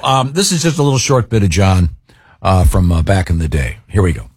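normal talking voice

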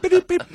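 short burst of high telegraph beeps; telegraph beeping. Retold or imitated. Telegraph finishing the arrest record, high beeping imitation. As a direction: imitate a Morse code machine signing off, high-pitched. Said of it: "There's a telegraph".

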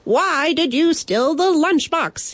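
high, dramatic mock shout; cracking shout. Would-be brave knight confronting the bandit, high cracking shout. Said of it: "he shouted, his voice cracking".

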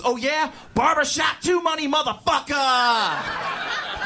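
high mock-angry boast, last word stretched; mad headliner's comeback. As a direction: throw it in the heckler's face. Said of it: "This dude was mad, right?".

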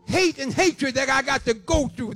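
ordinary delivery

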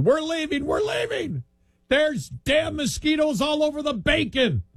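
imitating a high-pitched panicked scream; retold screaming. Scout's father fleeing mosquitoes at breakfast, screaming. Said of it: "you hear screaming".

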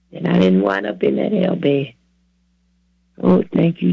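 ordinary talk

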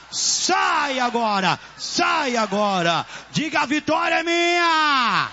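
slow, stretched shouted commands; chanting exorcist preacher, each phrase cried out; fervent deliverance prayer. Real, not acted. Leads deliverance prayer at full force.

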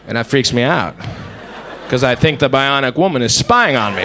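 usual speaking voice

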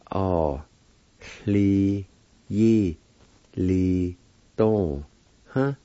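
"Ah, li, yi, li, dong, huh" with long gaps; syllable by syllable. Shown as a demonstration. Teacher showing how pending is said, syllable by syllable. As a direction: sound out each syllable slowly. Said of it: "It's pronounced like this".

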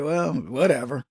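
low, dismissive shrug of a reply; shrugging it off. Retold or imitated. Mimicking a misgendered person who shrugs off the mistake, dismissive. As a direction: brush it off like it's nothing. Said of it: "shrug it off like".

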